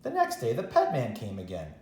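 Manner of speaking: usual speaking voice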